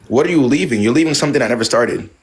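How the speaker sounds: ordinary talk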